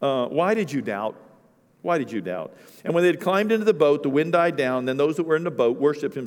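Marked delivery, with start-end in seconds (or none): 0.0-2.5 Jesus fondly chiding Peter's faith; gentle fond rebuke. Acted. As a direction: tease him tenderly, not scolding. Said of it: "he's not chastising him".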